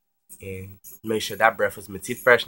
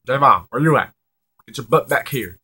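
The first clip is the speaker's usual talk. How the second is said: put-on loud, higher call to a kid; stern retold scolding; 3 semitones higher, 11 dB louder than usual. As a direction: order the child home loudly. Mom's boyfriend orders child home.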